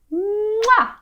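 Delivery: high, drawn-out mwah kiss noise; big cartoon smooch; exaggerated kiss. Shown as a demonstration. Storyteller voicing Beauty kissing back, exaggerated smooch. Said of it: "Can you do that?".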